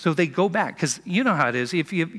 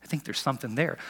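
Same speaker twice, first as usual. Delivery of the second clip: softer, half-said hint; subtle aside; 3 semitones lower, 8 dB quieter than usual. Retold or imitated. Nicodemus hinting to the council, softer and veiled. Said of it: "Subtly, you know, without saying it".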